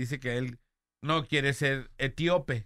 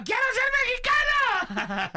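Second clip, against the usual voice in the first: put-on much higher, laughing yell of a kid; mock child shout; 4 dB louder, slower than usual. Retelling a toddler yelling he wants to be Mexican, laughing high shout. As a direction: shout it like a little boy begging, cracking up. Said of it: "Y gritaba".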